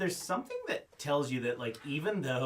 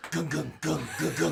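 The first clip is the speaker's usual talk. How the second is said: rhythmic "gung, gung" noise; imitated chugging noise; 3 semitones higher, 4 dB louder than usual. Joking with players about tracking something, imitated gung-gung noise.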